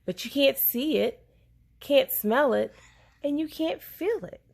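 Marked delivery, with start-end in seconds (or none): none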